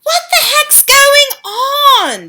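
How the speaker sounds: put-on high, slow puzzled boy voice; boy asking. A narrator's reading. Bruno asking the witches what is happening. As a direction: ask like a confused kid. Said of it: "Bruno asked".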